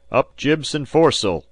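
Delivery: loud, high skipper's call; shouted order. A narrator's reading. Skipper to his crew as the wind takes her, shouting. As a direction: bellow the order across the deck. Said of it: "shouted Disco".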